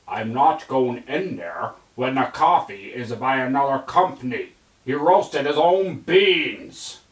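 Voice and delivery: put-on stubborn cat in a Scottish accent. Snowball refusing the cafe, Scottish accent.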